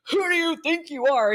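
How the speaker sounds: retelling a secretary's furious challenge; mock indignant demand. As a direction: snap it like a stern old secretary catching an intruder. Secretary confronts newcomer, retold.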